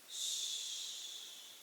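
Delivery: soft breathy shush; soothing shush. A narrator's reading. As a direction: hush him like a scared child. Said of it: "like he was a scared little kid".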